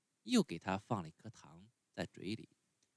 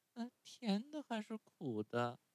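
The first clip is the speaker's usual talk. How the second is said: put-on high, whimpering child voice, much higher; whiny puppet voice; 13 semitones higher, 4 dB quieter than usual. A narrator's reading. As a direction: whine it like a sulky little boy about to cry. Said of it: "哭也似的问道".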